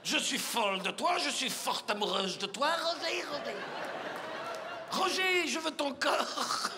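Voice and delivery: lovesick Belgian French with stretched words; Belgian accent. Acted. [name] throws themselves at Roger, Belgian accent. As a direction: woo in a hammed-up Belgian accent. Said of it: "c'est mon accent belge".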